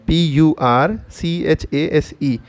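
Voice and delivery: slow letter-by-letter spelling. Shown as a demonstration. Teacher spells a new word for learners, slow spelling. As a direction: spell it slowly, each letter held apart.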